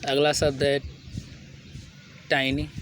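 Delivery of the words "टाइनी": "टाइनी" with first vowel as in tie; correct pronunciation. Shown as a demonstration. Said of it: "क्या होता है?".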